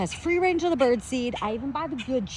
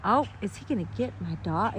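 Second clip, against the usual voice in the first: normal talking voice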